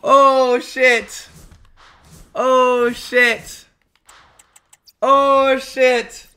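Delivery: high, loud, long-held exclamations; stunned exclaiming. Real, not acted. Streamer reacting to a big pull, stunned exclaiming. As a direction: cry out each one in amazement.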